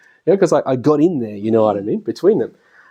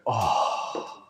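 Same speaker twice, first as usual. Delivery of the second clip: weary sigh; 4 semitones lower, 11 dB quieter than usual. Retold or imitated. Worst-day game, weary arrival.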